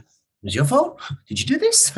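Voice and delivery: high-pitched questioning with a laugh; mock incredulous. Throwing an apology back at the sympathiser, mock incredulous. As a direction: play up the disbelief, voice leaping high.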